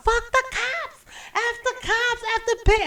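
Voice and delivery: high, defiant party shout; drunk defiant yell. Retold or imitated. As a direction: shout down the crowd. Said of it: "I'm screaming at the D J".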